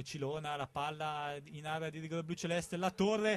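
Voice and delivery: fast Italian football commentary; live play-by-play. Real, not acted. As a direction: call the match live.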